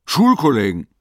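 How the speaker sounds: „Schulkollegen!“ hoch und laut ausgerufen, fallend; plötzlicher Einfall, laut herausgerufen; laut gerufen. Acted. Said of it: "Dann rief er". (Rabenwall ruft plötzlich, laut und hoch)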